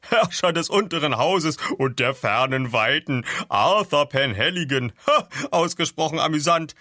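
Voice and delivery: loud, high, amused character voice; laughing mockery. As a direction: repeat the grand titles as a huge joke. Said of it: "brach er in schallendes Gelächter aus".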